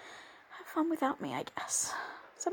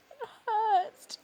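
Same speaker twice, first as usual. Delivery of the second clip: higher, louder crying; pained cry; 4 semitones higher, 5 dB louder than usual. Acted. Crying out in pain to her father.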